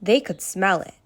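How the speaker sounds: plain reading voice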